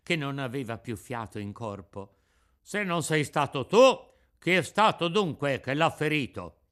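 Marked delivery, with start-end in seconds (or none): 2.6-6.6 louder, demanding officer; stern interrogation. A narrator's reading. The carabiniere interrogates Pinocchio, stern. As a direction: press the boy for the culprit.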